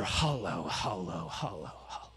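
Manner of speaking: low, fading echo of hollow; echo effect. Shown as a demonstration. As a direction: let hollow die away like an echo. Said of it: "it echoes".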